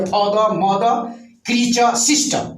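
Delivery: father, mother, creature, sister ending in open vowels; silent final r. Shown as a demonstration. Teacher shows silent endings, father, mother, creature, sister without r. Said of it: "ऐसे पढ़ेंगे".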